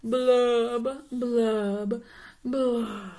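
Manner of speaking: drawn-out "blub", sinking in pitch; three long mopey fish bubbles; glum fish blubs. Acted. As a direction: blub slowly like a pouting fish. Pouting fish's drawn-out blub.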